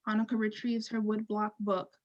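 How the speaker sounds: ordinary talk, nothing marked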